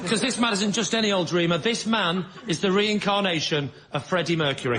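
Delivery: normal talking voice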